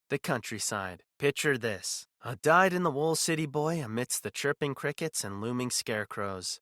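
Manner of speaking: ordinary delivery, nothing marked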